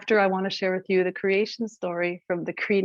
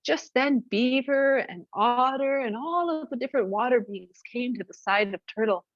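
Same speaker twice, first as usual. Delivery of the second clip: usual speaking voice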